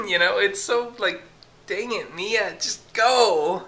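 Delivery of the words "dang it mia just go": exasperated nudge, louder at the end with a laugh; half laughing, shoo the cat out. Real, not acted.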